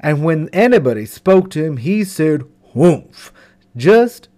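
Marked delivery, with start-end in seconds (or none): 2.7-3.3 loud dismissive grunt; grumpy humph. Acted. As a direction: huff it out like a sulky camel.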